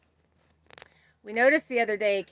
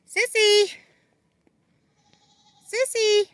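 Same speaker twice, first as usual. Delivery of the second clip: loud, much higher call across the yard; calling the goat; 13 semitones higher, 7 dB louder than usual. Real, not acted. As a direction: call out high to bring the goat over. Calling her goat over.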